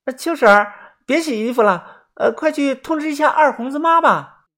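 high, urgent villager voice; urgent calling. Acted. Sending a neighbour to fetch Erhongzi's mother, high urgent call. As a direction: call out to a neighbour in pretend concern.

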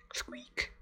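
very soft, whispered little squeak; mouse squeak. A narrator's reading. Mouse on the windowsill greeting the children, squeaking softly. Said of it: "a mouse sat on the windowsill".

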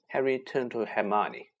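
plain reading voice

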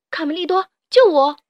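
loud, high cry for rescue; calling out. A narrator's reading. Nicolas calls Carmelito to save him, shouting. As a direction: call out loudly, hitting the plea hardest. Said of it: "尼古拉呼喊着".

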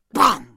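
loud, abrupt spoken bang; bang sound effect. A narrator's reading. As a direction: burst out with a sudden impact noise. Sound of the staff striking. (突然一声响亮的“砰”)

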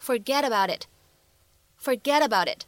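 "forget about it" slowed, t's flapped and run together as "forgedaboudit"; American flap T. Shown as a demonstration. Teacher modelling American linking for students, slow. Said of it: "会变成".

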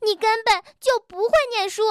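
又高又快、气冲冲地吼; 脱口而出的狠话; 气呼呼. Acted. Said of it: "气呼呼地说". (蹦蹦气急了快速高声吼)

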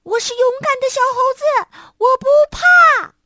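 high, fast, shouted 我是勇敢的小猴子 at full voice; loud brave shout. A narrator's reading. Little monkey shouts his brave words again, loud and high. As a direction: shout it out to chase the fear away. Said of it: "他又大声说".

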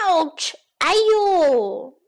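long, drawn-out yelp; hammed-up cry of pain, stretched out slowly; acted pain. Lion hit by stones and sticks, drawn-out mock yelp.

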